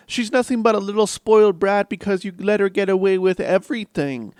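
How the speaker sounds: hammed-up accusing mother; overacted soap-opera scold; mock melodramatic mother. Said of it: "is like".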